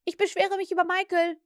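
Curly calls out to complain about Michael. A narrator's reading. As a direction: tattle on him right away. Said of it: "rief Curly sofort".

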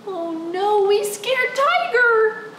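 high, drawn-out playful alarm; mock dismay. Storyteller realising they scared Tiger, high mock dismay. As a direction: wail it in pretend horror.

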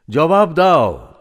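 loud, high, drawn-out demand for an answer. Acted. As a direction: press the lover hard for a reply. Speaker demands an answer from lover.

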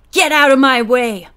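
loud, forceful warning. A narrator's reading. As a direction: warn off an intruder angrily. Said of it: "he warned".

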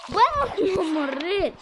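high, drawn-out outcry; loud dismayed exclamation. Real, not acted. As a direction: cry out slowly at your own blunder. Killed self in game. (exclamație prelungă, cu glas ridicat)

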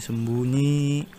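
"sembunyi" stretched long and slow; drawn-out singsong. Real, not acted. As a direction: draw out the last vowel of "sembunyi", gliding upward. Singsong hiding call while playing.

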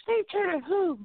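high mock female voice; wife asking who is calling; imitating wife. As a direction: ask puzzled in her high voice. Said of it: "wife voiced high".

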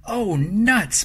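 high, loud dismayed cry; cried out. A narrator's reading. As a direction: exclaim it in childlike dismay. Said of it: "Margaret cried".

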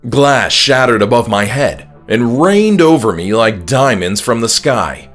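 ordinary delivery, nothing marked

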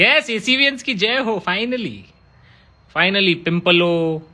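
high, bursting cheer, then slowing; excited cheer. Real, not acted. Streamer cheering a fan's topic, excited high cheer. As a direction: cheer like the wait is finally over.

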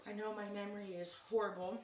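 normal talking voice

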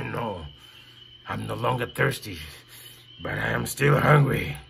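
very low, soft growly voice; deep lion. Acted. Lion tells the boy he is still hungry, very low.

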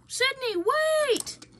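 high, loud desperate call; shouting. Acted. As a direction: call out after him. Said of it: "shouted Afton".

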